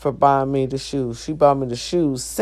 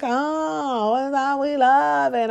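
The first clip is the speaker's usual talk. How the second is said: high airy gushing coworkers, lightly done; 10 semitones higher, slower than usual. Retold or imitated. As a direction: mimic the coworkers fawning over the boots. Coworkers greeting her boots.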